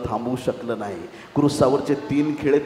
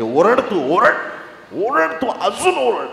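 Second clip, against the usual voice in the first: raised, high-pitched insistent call; urgent urging; 7 semitones higher, 4 dB louder, slower than usual. Acted. Lord urging Bartimaeus to cry from the heart, urgent call. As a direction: push each command higher, drawing it out.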